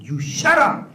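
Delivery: sudden high, loud shout. Retold or imitated. Boss shouting at a failing salesman. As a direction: bark it like an angry boss. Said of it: "He shouted at me".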